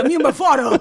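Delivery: put-on Boston accent with a lisp. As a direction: say it like a Bostonian with a speech impediment. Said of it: "That's a Boston accent with the speech impediment".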